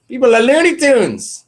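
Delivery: exasperated outburst. Real, not acted. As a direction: throw your hands up at the chat. Exasperated at commenters.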